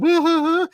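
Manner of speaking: loud high-pitched mock wail; imitated phone wailing. Retelling a woman on the phone mid-breakup, mock wailing.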